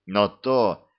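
ordinary delivery, nothing marked

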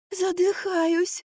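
very high, drawn-out feeble wail; suffocating. A narrator's reading. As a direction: stretch it out as if out of air. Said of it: "подала голос Марфа". (тонко и протяжно, задыхаясь)

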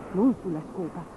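ordinary delivery, nothing marked

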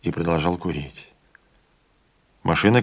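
plain reading voice

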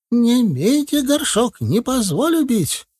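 high, slow aghast granny; protesting old healer. A narrator's reading. Целительница защищает мамкин горшок, высоким протестующим голосом. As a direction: plead for the heirloom pot.

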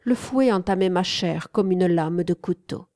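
ordinary delivery, nothing marked

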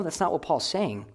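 normal talking voice

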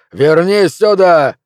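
high, loud and slow; loud shout. Acted. Flint yelling at Pelvis to come back, loud and high. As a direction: haul him back with a yell.

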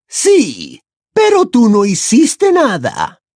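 high, loud angry retort; high-pitched scornful villainess; Bellatrix, spat accusation. A narrator's reading. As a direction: spit it out as an accusation. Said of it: "le espetó Bellatrix".

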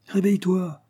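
urgent cry. A narrator's reading. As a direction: cry out to rouse a sleeping friend. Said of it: "s'écria Raphaël".

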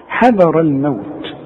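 حذر الموت slow, with a heavy ر; careful recitation. Shown as a demonstration. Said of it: "شوف حذر".

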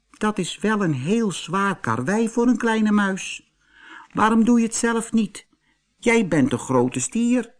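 very high, light mouse voice; little mouse. Acted. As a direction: squeak it out as a tiny mouse. Mouse talks to the bull.

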